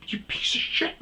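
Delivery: imitating himself yelling; retold yelling. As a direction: yell it while getting out of the car. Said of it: "I'm yelling".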